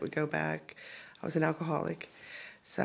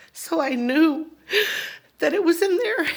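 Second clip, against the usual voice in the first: high, loud, choked with emotion; overwhelmed by the memory, voice climbing; real tearful distress; 10 semitones higher, 5 dB louder than usual. Real, not acted. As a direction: push the words out through rising tears. Relives being shot, near tears.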